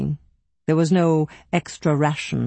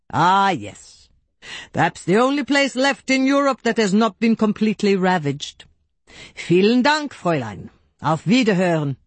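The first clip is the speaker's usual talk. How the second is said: lightly raised, formal telephone official's voice; courteous German caller; 4 semitones higher than usual. A narrator's reading. Herr Schmidt ending his call to the secretary, formal and courteous.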